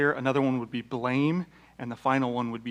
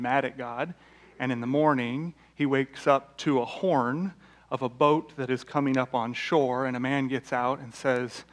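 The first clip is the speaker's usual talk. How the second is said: normal talking voice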